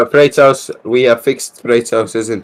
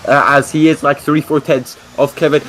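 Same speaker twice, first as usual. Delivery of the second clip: excited, higher-pitched play-by-play; live race commentary; 7 semitones higher than usual. Acted. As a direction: call the qualifying lap as it happens.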